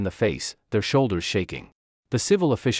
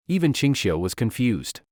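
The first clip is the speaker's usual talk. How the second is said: ordinary delivery, nothing marked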